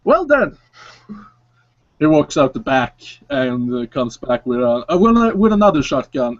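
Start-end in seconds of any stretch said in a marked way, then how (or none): 0.0-0.6 knowing clerk. Acted. Clerk reacts to no permit.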